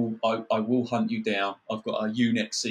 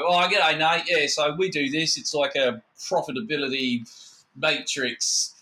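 mock Australian salesman; Australian accent; 4 semitones higher than usual. Australian stranger pitching buzzwords to Nate, in a mock Australian accent. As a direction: put on a chirpy Australian pitch, starting high. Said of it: "So he was Australian".

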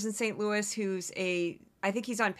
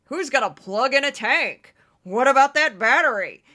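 Joe Concha impression; 4 semitones higher, 9 dB louder than usual. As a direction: play a generic Fox pundit scoffing at tanks. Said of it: "That's my Joe Concha".

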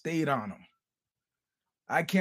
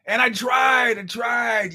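emphatic; 5 semitones higher, 5 dB louder, slower than usual. Real, not acted. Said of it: "shout heard".

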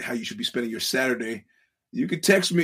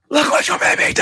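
loud, high stage whisper shout; whisper-shout it with full force, very high; whispered mocking mimicry; 13 semitones higher, 8 dB louder, faster than usual. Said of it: "in the best whisper voice possible".

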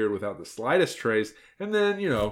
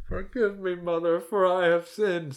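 put-on lightly raised, slow old woman's voice; a pious old grandmother pleading, pitched higher; old woman begging forgiveness, higher and slow; old woman confessing; 9 semitones higher than usual. Retake after laughing.

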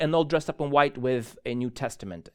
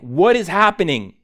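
louder, higher exclamation of disbelief; loud, incredulous reenacted question; retold bewilderment; 4 semitones higher, 10 dB louder than usual. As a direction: exclaim your baffled inner thought. Bewildered thought amid retold chaos.

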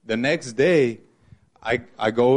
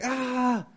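short high imitated shriek, much higher; a quick high squeal, imitating mom; mock shriek; 13 semitones higher, 4 dB quieter than usual. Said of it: "like, she shrieks".

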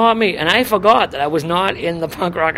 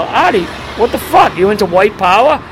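retold incredulity; 3 semitones higher, 5 dB louder than usual. Young punk confronting Artie about the stickers, acted-out incredulity. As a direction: challenge him like you can't believe it.

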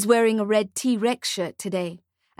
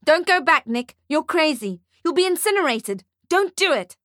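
1 shouting; 7 semitones higher than usual. A narrator's reading. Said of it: "Ferdy shouts at Nick".

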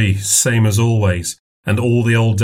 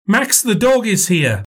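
put-on little girl voice; 11 semitones higher than usual. A narrator's reading. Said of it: "child announces dog".

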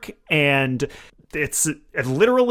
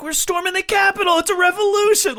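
mock zealot yell. Maced protester proudly shouting, high giddy yell. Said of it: "She's like".

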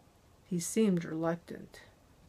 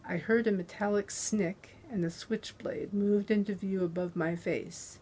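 usual speaking voice

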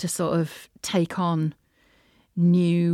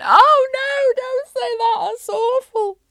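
high, loud mock-horrified squeal; mock horror; 8 dB louder, slower than usual. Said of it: "part of me went".

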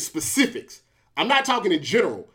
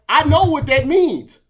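emphatic; 5 semitones higher, 6 dB louder than usual. Real, not acted. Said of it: "building insistence".

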